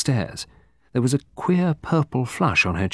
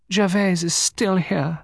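high, slow, matter-of-fact lady's voice; high-voiced lady, quite matter-of-fact; 10 semitones higher, slower than usual. A narrator's reading. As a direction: state it simply in a high, unhurried voice. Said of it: "in a matter-of-fact tone".

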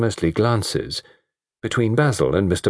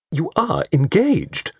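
slow high reproach; 9 semitones higher, slower than usual. A narrator's reading.

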